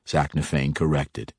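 ordinary delivery

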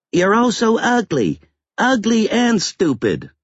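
put-on very high little-boy voice, squealed insults; small child's high-pitched tantrum; angry child. A narrator's reading. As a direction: taunt like a furious five-year-old. Five-year-old boy insulting his father.

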